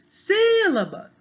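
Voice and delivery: SÍLABA with SÍ very high, loud and long; calling the word. Shown as a demonstration. Teacher showing first-syllable stress, calling SÍÍlaba. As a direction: call out sílaba, holding the first SÍ. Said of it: "o si é mais forte".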